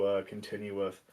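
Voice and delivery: ordinary talk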